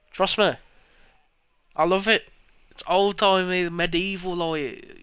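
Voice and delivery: high, loud over-the-top enthusiasm; hammed-up fan gushing; mock gush. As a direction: gush sarcastically about the art style. Gushing at chat about the game.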